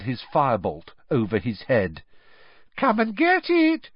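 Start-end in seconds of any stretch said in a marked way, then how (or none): 2.8-3.9 high-pitched, taunting mermaid; high mocking singsong tease; malicious giggling. A narrator's reading. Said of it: "she giggled maliciously".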